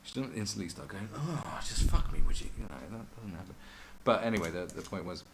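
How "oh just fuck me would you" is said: high, loud mock breathy plea; hammed-up girl begging; mocking a woman's come-on. Mocking drugged girl's line.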